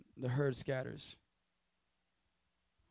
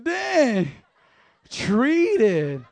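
high, loud mock amazement; amazed reaction; 4 semitones higher, 6 dB louder than usual. Pastor retelling his own reaction in class, high and loud. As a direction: burst out, stunned. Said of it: "I was like".

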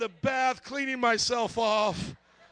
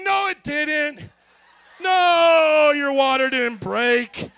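loud, high, drawn-out NO; emphatic denial; 6 semitones higher, 11 dB louder, much slower than usual. Retold or imitated. Husband telling wife her water didn't break, loud stubborn denial. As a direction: shout her down in stubborn disbelief.

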